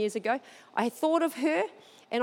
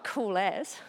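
playing it cool; 4 semitones lower, 5 dB quieter than usual. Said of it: "I sit down and I'm like".

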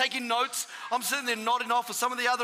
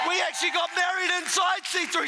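raised, driving sermon voice; preacher urging the church on; full-force preaching; 5 semitones higher than usual. Acted. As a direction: press the congregation to keep praying.

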